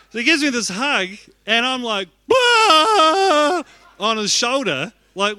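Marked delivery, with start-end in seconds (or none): 2.3-3.6 much higher, much louder burst of a hammed-up sob; sobbing wail. Pastor hammily bawling on the guest speaker's shoulder. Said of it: "I'm crying on another man's shoulder".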